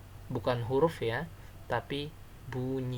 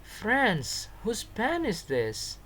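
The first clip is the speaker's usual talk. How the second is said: high, slow call to a group; acted calling out; 9 semitones higher, much slower than usual. Shown as a demonstration. Samir asking all his friends, high drawn-out call. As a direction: pitch it up and stretch the question out.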